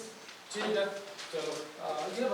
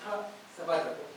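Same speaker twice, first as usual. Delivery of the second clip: usual speaking voice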